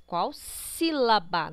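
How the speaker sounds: SÍLABA slowed right down and punched; stress on sílaba. Shown as a demonstration. Teacher corrects a likely mix-up with palavra, sílaba heavily stressed. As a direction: lean hard and slowly on the word sílaba. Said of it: "Não é a palavra".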